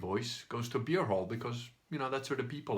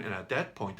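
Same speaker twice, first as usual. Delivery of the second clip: ordinary delivery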